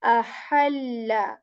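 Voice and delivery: ahalla drawn out slowly with a breathy middle ha; ahalla with airy ha. Shown as a demonstration. Teacher letting students hear the difference. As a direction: slowly push air on the ha of ahalla. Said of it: "But here we say".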